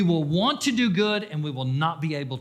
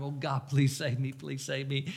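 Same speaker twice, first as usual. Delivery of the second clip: softer, hushed plea as if crying; retold tearful plea; 3 semitones lower, 8 dB quieter than usual. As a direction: recall the tearful prayer quietly. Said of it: "I'm crying".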